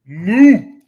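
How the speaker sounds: short, very loud moo; cow mooing. Acted. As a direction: bellow like a yanked cow. Voices the halted cow.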